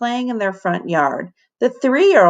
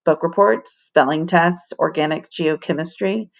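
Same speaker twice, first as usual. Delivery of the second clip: ordinary delivery, nothing marked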